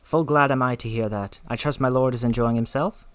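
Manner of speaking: put-on a light Scottish accent for Lena. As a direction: read her lines in a gentle Scottish brogue. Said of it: "Lena has a Scottish accent".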